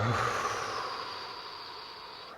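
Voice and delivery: long audible exhale sigh; dramatic sigh. Shown as a demonstration. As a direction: release the breath with a sigh. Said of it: "Big full inhale and exhale".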